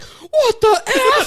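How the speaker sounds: high, loud, drawn-out disbelief; mock shocked yell. Retelling their shock at the billboard, high mock yell. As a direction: yell it slowly in comic outrage.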